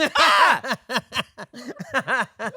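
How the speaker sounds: high burst of open laughter; big laugh. Real, not acted.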